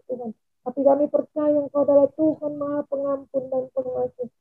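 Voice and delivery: usual speaking voice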